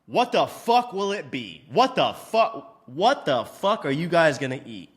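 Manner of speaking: slow, exasperated what the fuck; impatient snap. Retold or imitated. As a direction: bark the order question at the customers like a rude waiter. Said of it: "And I just go".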